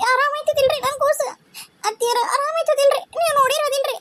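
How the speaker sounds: high, whispered then crying plea; tearful pleading. Acted. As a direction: beg for news of the baby through tears. Young mother fearing for her baby. (ಅಳುತ್ತ, ಪಿಸುಗುಡುತ್ತ ಬೇಡಿಕೊಳ್ಳುವ ದನಿ)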